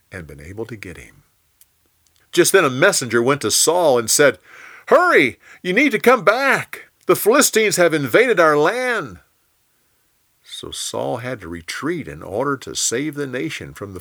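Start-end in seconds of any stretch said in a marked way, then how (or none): 4.9-6.9 high, loud urgent cry; breathless alarm from a messenger. A narrator's reading. Messenger urgently warns Saul. 7.1-9.2 high loud cry on Philistines; urgent warning. A narrator's reading. Messenger warning.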